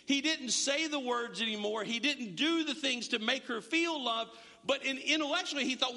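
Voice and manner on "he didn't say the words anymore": normal talking voice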